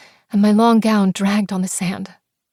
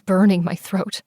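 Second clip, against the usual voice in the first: plain reading voice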